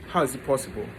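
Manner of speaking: usual speaking voice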